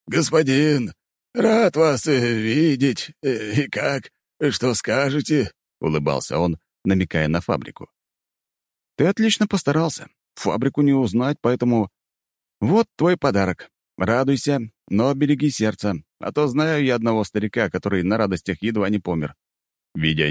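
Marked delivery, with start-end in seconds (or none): 0.1-5.5 higher, halting, hesitant voice; flustered old craftsman. Acted. Tired but pleased old craftsman. 9.0-19.3 higher, lightly voiced praise; a hint of a pleased lord; pleased master. Master praises his worker.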